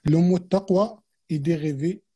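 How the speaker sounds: ordinary delivery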